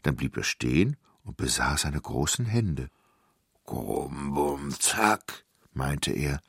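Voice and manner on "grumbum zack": deep giant nonsense words; big giant's odd exclamation; pondering giant. A narrator's reading. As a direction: rumble it low, then a quick last word.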